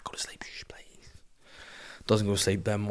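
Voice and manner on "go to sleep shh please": barely audible hushed plea; whispered begging. Retold or imitated. Dad begging the baby to sleep, whispering. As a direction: whisper a desperate hush.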